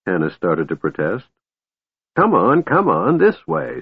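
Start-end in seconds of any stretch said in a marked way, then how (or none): 2.2-3.8 put-on higher, urging old mage; insistent old wizard. A narrator's reading. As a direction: hurry them along like a stubborn old wizard. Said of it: "said Fizban insistently".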